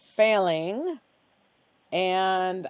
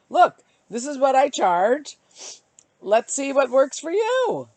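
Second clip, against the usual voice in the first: confident offer; 6 semitones higher, 6 dB louder than usual. Pretend. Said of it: "what if I was just free".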